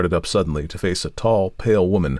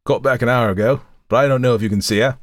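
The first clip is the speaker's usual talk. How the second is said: raised, louder voice of a charwoman; 6 semitones higher, 4 dB louder, faster than usual. A narrator's reading. Charwoman to a caller at the door, brusque charwoman voice. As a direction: answer like a doubtful charwoman.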